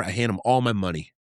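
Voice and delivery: normal talking voice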